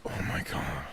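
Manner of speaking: voiceless whispered gasp of shock; soft, breath-only retold exclamation; hushed mock shock. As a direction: whisper it as an imitated stunned reaction. Voicing a film character's shock.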